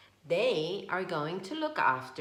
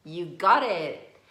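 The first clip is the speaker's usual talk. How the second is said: cheerful praise; 9 dB louder than usual. Real, not acted. Praising child for finding cat.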